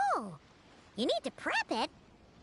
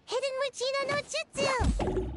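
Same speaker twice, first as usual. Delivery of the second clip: ninja move call; 6 semitones higher than usual. Acted. Announcing a ninja technique.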